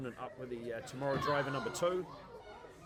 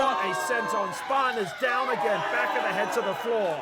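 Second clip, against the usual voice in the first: high, loud hyped call; excited shout; 8 semitones higher, 11 dB louder than usual. Real, not acted. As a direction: shout the impact in awe. Reacting to a big dive.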